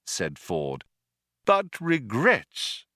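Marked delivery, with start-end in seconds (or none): 1.4-2.9 lightly voiced old official, pitched higher; polite recorded voice with a hint of menace; understated courteous menace; old, courteous announcer. A narrator's reading. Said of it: "continued the voice".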